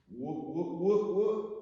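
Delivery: yelp, fighting to stay up; wobbling whoops. Real, not acted. Teacher losing balance a second time, rising whoops. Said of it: "Second save".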